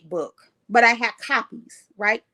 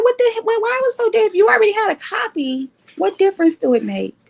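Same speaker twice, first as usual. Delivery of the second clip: much higher, incredulous imitation; mock baffled questioning; 10 semitones higher than usual. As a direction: mimic people puzzled by all the fuss. Acts out others questioning her fuss.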